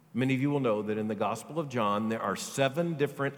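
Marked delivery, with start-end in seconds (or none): none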